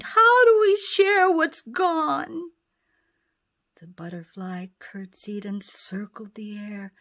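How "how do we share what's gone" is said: high, loud whiny plea; whining. Acted. Hare whining to the butterfly, high and loud. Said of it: "a hare whined".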